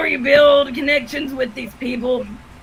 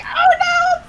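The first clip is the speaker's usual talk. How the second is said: much higher "Oh, no!" in mock alarm; higher retelling of the startled cry. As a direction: squeak it out, horrified. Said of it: "And I was like".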